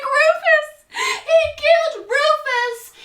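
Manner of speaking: very high, loud, slow hysterical cry; hysterical wail. Acted. Meg tells Dan West killed her cat, high hysterical wailing. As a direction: wail it out as the accusation. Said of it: "she's like".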